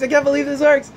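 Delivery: echoing the disbelief, still pitched high; a high, mock-stunned repeat; amazed client. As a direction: say it again, just as wowed. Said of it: "and they're like".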